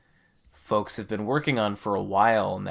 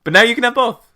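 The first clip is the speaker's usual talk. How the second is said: higher and louder, bursting with excitement; excited; 11 semitones higher, 11 dB louder, faster than usual. Real, not acted. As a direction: cheer it out with delight. Excited payoff after long explanation.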